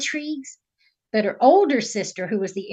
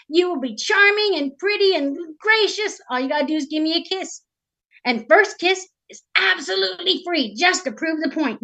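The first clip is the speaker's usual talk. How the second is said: high, eager huckster frog voice; wheedling frog salesman; 6 semitones higher than usual. Acted. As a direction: sweet-talk the princess into a deal. Storyteller voices the frog's sales pitch.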